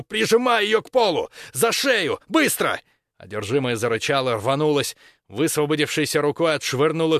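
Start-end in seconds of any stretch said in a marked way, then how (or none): 0.0-2.8 high, loud urgent shout. A narrator's reading. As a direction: yell the orders to a friend. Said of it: "крикнул другу".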